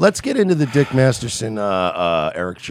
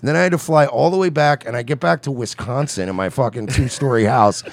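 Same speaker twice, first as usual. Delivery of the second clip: ordinary talk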